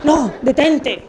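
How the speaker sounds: loud, playful command to stop; mock-urgent order to oneself; mock self-restraint. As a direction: halt yourself out loud, hammed-up. Acting out stopping oneself from killing.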